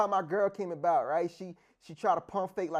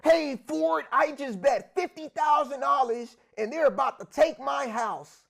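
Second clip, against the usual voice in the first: high frantic liar; 7 semitones higher, 8 dB louder than usual. Retold or imitated. Liar's exaggerated claim voiced.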